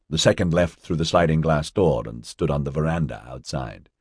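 ordinary delivery

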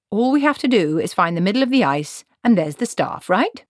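put-on a no-nonsense old witch taking charge; stern old woman. Granny Weatherwax speaking.

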